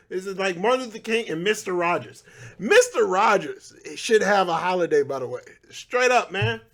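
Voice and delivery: ordinary talk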